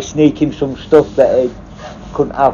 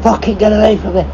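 higher, mock furious yell at the officer; raging prisoner; 9 semitones higher than usual. Drunk prisoner warns the negotiator to keep away, mock furious higher yell. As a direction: imitate a drunk prisoner warning you off, a little higher. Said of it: "he's so fucking raging".

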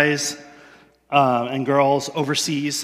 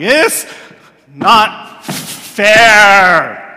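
put-on loud, higher, drawn-out whine like a toddler; 3 semitones higher, 10 dB louder, much slower than usual. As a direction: sulk it out like a small child. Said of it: "turn into toddlers".

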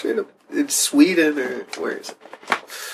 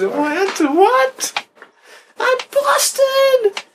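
very high squeal; mock shock; 3 dB louder, much slower than usual. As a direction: shriek it in fake alarm. Mock outrage at being busted.

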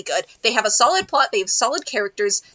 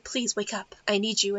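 soft pleading quote; 4 semitones lower, 9 dB quieter than usual. Retold or imitated. Quoting dramatic revival line.

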